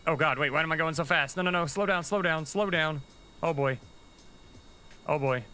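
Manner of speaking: high-pitched, urgent pleading with the craft; real alarm, pitch jumping up on repeated commands. Real, not acted. Rover suddenly going too fast.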